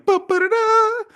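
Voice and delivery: high, loud sung trumpet call; mock heroic fanfare. Boyfriend arrives to save her, mock fanfare. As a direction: blare out a superhero entrance.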